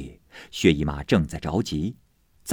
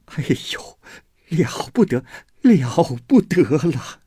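higher, drawn-out slow cry of alarm; panicked wail; 8 semitones higher, 3 dB louder than usual. A narrator's reading. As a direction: wail it out like disaster has struck. Servant panicking over a death. (惊慌地拖长哀叫，音调偏高)

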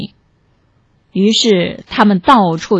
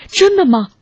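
higher-pitched, flustered ask; anxious, raised pitch; flustered question; 8 semitones higher than usual. A narrator's reading. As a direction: ask in mild panic. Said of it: "慌乱地问".

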